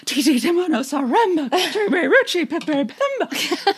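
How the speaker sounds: high, fast, breathless sing-song name; mom's theatrical storytelling voice. Retold or imitated. Daughter imitating her mom reading the long name breathlessly, high and fast. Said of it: "my mom would just read that with such inflection and personality".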